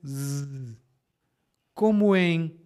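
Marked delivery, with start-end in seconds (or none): 0.1-0.8 "Z" as a held buzzing z, not the letter name; "Z" alone, no vowel; z sound. Shown as a demonstration. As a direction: sound out a long "Z" buzz. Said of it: "O primeiro é Z".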